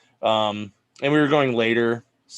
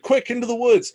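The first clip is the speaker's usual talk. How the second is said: mock shout; 12 semitones higher, 4 dB louder than usual. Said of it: "and there's like".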